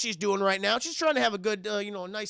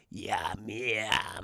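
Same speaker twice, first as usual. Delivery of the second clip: very low monster voice; low gremlin-like eating voice; creature voice; 12 semitones lower, 6 dB quieter than usual. Retold or imitated. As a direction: play a little monster, deep in the throat. Voicing the creature.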